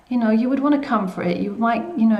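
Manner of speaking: ordinary talk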